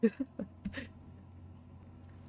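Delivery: soft breathy giggle; awkward little laugh; embarrassed laugh. Real, not acted. Embarrassed about the accent.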